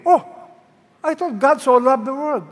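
high, loud mock protest; puzzled objection. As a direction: object like a baffled listener. Voicing the same objection again.

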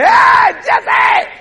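piercing high, loud call; excited shout from the throne; God hollering. Acted. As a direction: holler the name at the top of your range. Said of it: "But God would say".

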